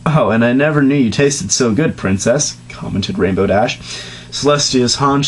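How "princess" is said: ordinary talk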